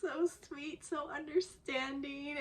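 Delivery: gushing fan. Real, not acted. Fan swooning over the hero, gushing. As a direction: melt over how sweet he is. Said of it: "which I'm just like".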